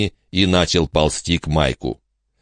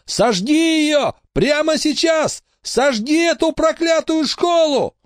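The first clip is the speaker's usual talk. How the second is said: high, drawn-out screamed order; desperate shouting; slower than usual. A narrator's reading. Boy orders his friend to burn the school, high desperate shout. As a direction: yell it urgently, stretching the words. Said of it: "закричал он".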